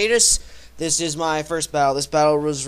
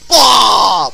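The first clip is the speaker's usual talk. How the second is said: loud frustrated outburst; game frustration yell; mock frustrated blah; 3 semitones higher, 11 dB louder than usual. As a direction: blurt it out in disgust. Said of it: "I'm just like".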